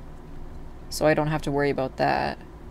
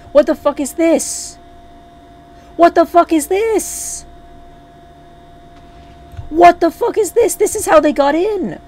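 high-pitched, loud shouts of outrage; real angry outburst; 12 semitones higher, 8 dB louder than usual. Real, not acted. Player discovers how they got in, furious shouting. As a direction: yell in furious disbelief. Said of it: "Oh, I'm mad".

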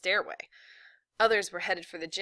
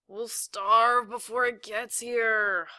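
long, loud, higher-pitched whine; groaning complaint; 4 semitones higher, 5 dB louder, much slower than usual. A narrator's reading. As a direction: drag it out like a starving kid. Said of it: "Mike groaned".

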